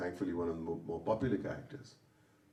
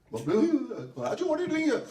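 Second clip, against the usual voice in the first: startled call; 7 dB louder than usual. Retold or imitated. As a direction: jolt awake and call out. Startled waking, calling out.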